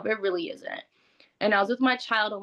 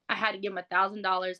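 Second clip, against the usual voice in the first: ordinary delivery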